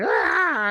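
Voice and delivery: high, loud retold yelp; mock scream. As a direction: cry out at the broken mirror. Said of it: "and he's like".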